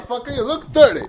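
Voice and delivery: high, loud, fast comic jab; hammed-up angry father; mock indignant dad. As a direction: confront him in mock outrage, half laughing. Acting out dad confronting the date.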